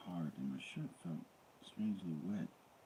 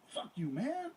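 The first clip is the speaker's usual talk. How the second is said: high, fast, heated protest; angry protest. A narrator's reading. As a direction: spit it back at him, fast and high. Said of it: "Goldman protested".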